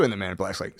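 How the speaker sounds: usual speaking voice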